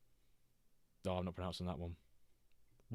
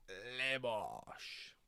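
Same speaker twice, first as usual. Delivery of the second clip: "Le Bosch" softly in a put-on French accent, long falling "Le"; soft French-accent impression; mock French accent; 5 dB quieter than usual. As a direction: quote his French catchphrase. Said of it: "and he's like".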